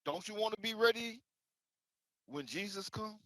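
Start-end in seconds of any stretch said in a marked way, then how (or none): none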